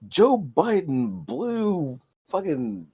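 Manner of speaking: high, very drawn-out mock headline; mock tabloid headline. Mocking today's absurd tabloid covers, drawn-out headline. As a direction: stretch each word of a ridiculous headline.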